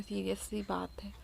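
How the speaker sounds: soft whisper, breath only; a hushed, serious whispered aside. Real, not acted. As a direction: drop to a whisper for the serious bit. Aside about the serious operation.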